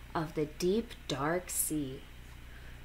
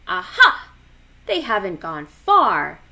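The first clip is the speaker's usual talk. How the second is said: excited; 2 semitones higher, 11 dB louder than usual. A narrator's reading. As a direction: burst out with delight. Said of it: "he said".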